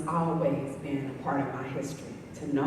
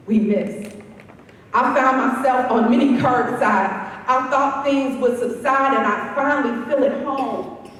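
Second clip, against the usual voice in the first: loud, projected poetry recital, raised in pitch; spoken-word poem; 9 semitones higher, 11 dB louder than usual. Acted. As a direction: declaim it in rhythmic phrases, pausing between images. Performs her poem on freedom.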